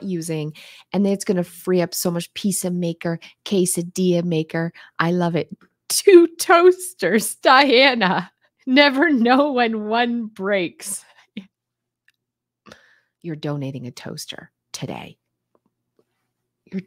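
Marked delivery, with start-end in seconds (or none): 5.9-11.0 mock exasperation. Mocking a viewer's excuse.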